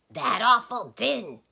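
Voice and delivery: loud, high, slow emphatic answer. A narrator's reading. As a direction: belt out the answer. Tock answers the doctor's quiz.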